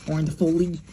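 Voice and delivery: ordinary delivery, nothing marked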